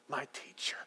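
whispered gloss of the word. Acted. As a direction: breathe it out in a whisper. Quiet meaning of Rabboni.

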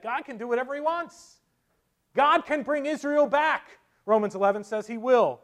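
high, loud, ringing declaration; full-force preaching. Real, not acted. Preacher declaring God can restore Israel, preaching forcefully. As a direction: proclaim it with full conviction.

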